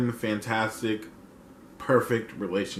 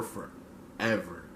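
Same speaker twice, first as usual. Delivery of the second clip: much softer, lower, slow fade-out; fading echo whisper; 5 semitones lower, 12 dB quieter, slower than usual. Acted. Echoing the queen's lyric to viewers, fading to nothing. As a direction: let the last forever die away like a song ending.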